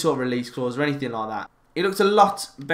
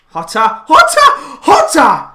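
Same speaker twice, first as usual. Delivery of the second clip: excited shout; 10 semitones higher, 12 dB louder, slower than usual. Real, not acted. Shouting at a shot on goal.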